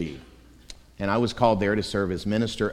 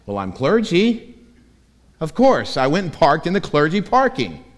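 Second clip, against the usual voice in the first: mock self-importance; 5 semitones higher, 4 dB louder than usual. Mocking his younger proud self.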